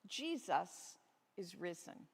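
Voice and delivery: ordinary delivery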